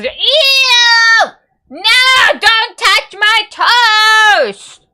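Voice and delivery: high, loud, slow wail over the toast; hammed-up high-pitched shout; possessive shriek. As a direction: screech like someone guarding food. Acting out the video scene.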